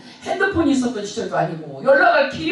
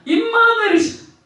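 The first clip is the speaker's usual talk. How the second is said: fervent prayer; 5 semitones higher than usual. Retold or imitated. Praying inwardly amid the quarrel, fervent then whispered. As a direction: pour out a heartfelt prayer, then hush to a whisper.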